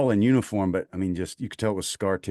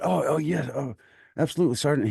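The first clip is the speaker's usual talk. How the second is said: high, eager yes-sir voice; imitating a keen private jumping to help; eager young private; 7 semitones higher than usual. Private answering the sergeant, high and eager. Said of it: "And he said".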